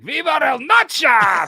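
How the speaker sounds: high, drawn-out, loud holler; mock battle cry. Classmate ambushing a buddy in Spanish class, shouting. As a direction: bellow it like a war cry.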